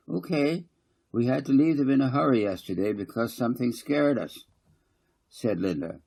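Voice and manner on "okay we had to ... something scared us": put-on higher child voice, eager to tell; a small girl explaining to her mum; little girl voice.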